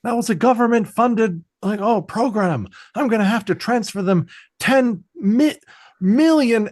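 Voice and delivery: high-pitched exasperated boss; hammed-up indignant outburst; mock chewing-out. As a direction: imitate a boss ranting about the bill. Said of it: "bitching them out".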